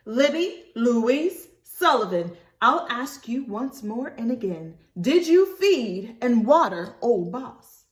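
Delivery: slow, loud, weighty scold; stern full-name scolding. Acted. Mama scolding Libby by full name, slow and stern. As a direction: make every word count. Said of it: "real stern-like".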